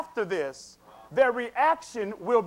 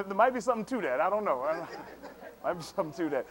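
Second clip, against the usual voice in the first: sly chuckling aside; 3 semitones lower, 5 dB quieter, much faster than usual. Real, not acted.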